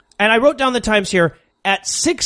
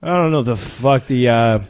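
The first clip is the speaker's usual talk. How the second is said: mock a listless preacher way down low; droning pastor; 10 semitones lower than usual. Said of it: "a pastor with all of Sam Harris's warm inflections".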